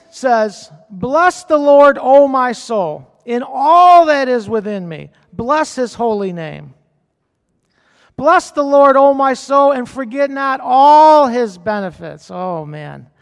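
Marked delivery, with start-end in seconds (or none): 0.9-12.3 fervent scripture reading. Acted. Pastor reading Psalm 103 to his church, loud and fervent. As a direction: lift each line of praise up to the room.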